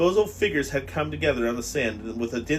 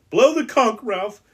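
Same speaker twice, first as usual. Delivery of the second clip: much higher, loud cry; urgent plea; 10 semitones higher, 7 dB louder than usual. Acted. Piggy urges Ralph in panic to blow the conch. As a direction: beg him to act now.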